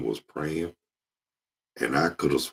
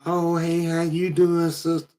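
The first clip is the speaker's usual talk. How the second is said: high syrupy church-lady greeting; fake church lady. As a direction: gush sweetly with put-on concern. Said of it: "old fake women".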